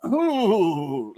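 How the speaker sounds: long, high mock-shiver "ooh"; a drawn-out ooh, imitating someone with the shivers; imitated shivery ooh. Imitating the coin dealer shivering over a pretty penny, shivery ooh. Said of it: "He got the shake, the shivers".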